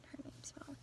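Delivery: a near-silent whisper of the name. Real, not acted. Whispering the name again with the dog right there. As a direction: breathe the name out unvoiced. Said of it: "I just said that 'cause my dog's right there".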